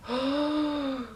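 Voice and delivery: sharp gasp of awe; breathless gasp of wonder; awed gasp. Real, not acted. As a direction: gasp in real delight. Awed by the highlight.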